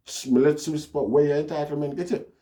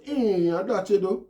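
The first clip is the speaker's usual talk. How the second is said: taken-aback retort; 8 semitones higher than usual. Retold or imitated. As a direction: retell it as a high-pitched, incredulous reproach. Recoiling reaction quoted in story.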